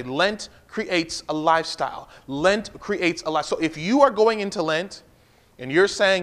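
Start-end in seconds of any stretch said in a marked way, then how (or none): 0.0-3.4 slow, spelled-out phrase for notes; slow dictation. Shown as a demonstration. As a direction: dictate it slowly so they write it down. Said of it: "get that in your notes. Say".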